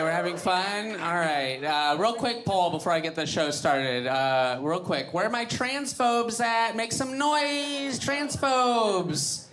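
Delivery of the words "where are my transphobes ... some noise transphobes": high, slow hype-man call; mock hype call for the transphobes; hype call to crowd. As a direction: whip the crowd up like an MC. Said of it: "crowd work".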